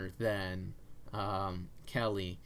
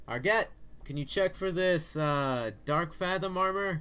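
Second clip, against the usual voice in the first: calling out; 9 semitones higher, 5 dB louder than usual. Acted. NPC calls to the back room.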